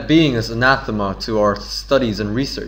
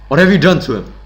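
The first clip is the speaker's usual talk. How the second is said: accusing demand; 5 semitones higher, 6 dB louder, faster than usual. Acted. Repeated accusing demand in dialogue.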